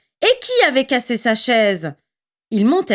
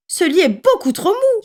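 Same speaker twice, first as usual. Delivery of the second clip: much higher whiny complaint; whining; 10 semitones higher, 3 dB louder than usual. A narrator's reading. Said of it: "gémit-elle".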